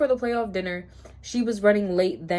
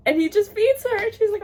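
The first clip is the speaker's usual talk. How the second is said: high, loud, delighted outburst; overwhelmed squeal; 12 semitones higher, 6 dB louder than usual. Real, not acted. Reader retelling a sweet cooking scene, overwhelmed squeal. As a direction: squeal it as a swoon.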